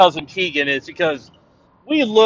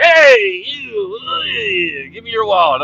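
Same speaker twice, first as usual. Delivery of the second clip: mugger; slower than usual. Retold or imitated. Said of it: "a robber's like".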